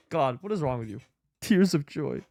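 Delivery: usual speaking voice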